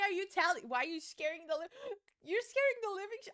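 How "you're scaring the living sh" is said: gasping, high and fast; startled by a spider, cut off mid-word. Real, not acted. Streamer jolts at a spider, startled gasp.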